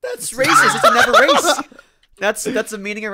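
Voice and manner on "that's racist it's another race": high, loud mock outrage; mock shout. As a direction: yell it in fake shock.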